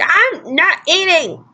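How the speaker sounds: high, drawn-out indignant yell; imitating her own angry outburst; retold yell. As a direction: stretch out each word in a high protest. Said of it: "I was yelling at my dad".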